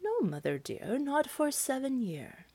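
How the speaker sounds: fib to the witch softly and calmly; quiet, lightly voiced tree answering the witch; apple tree answering. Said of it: "The apple tree answered".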